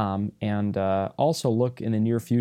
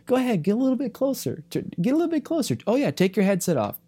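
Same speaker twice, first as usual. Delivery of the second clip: teasing captain; 13 semitones higher, faster than usual. Retold or imitated. Captain teasingly urges him closer.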